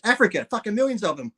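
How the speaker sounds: high-pitched talking parrot. Acted. Parrot answering the barman with the punchline, high parrot voice. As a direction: pipe up like a joke parrot. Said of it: "The parrot goes".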